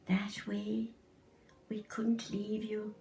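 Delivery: tender whisper. Acted. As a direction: whisper it lovingly to the child. Mum answers the child tenderly.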